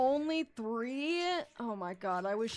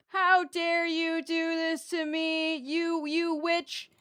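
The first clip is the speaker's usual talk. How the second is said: slow, wailing rage; crying with rage; 4 semitones higher, 4 dB louder, slower than usual. Real, not acted. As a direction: whine the accusation bitterly. Cursing the game character.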